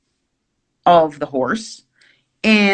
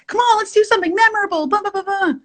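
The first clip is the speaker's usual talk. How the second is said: very high, rapid mock cajoling; squeaky-high, hurried "come on" act; imitating own eager pleading; 14 semitones higher, much faster than usual. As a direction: parody your own coaxing, pitched way up and rushed. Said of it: "I was the one that was like".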